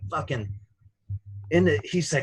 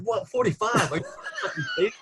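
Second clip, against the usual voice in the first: laughing disbelief; 5 semitones higher, 4 dB quieter, faster than usual. Retold or imitated. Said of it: "I'm like".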